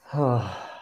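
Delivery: long sighing breath out through the mouth; demonstrated meditation exhale; audible exhale. Shown as a demonstration. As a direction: let the breath go in an open-mouthed sigh. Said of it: "Breathing out through the mouth".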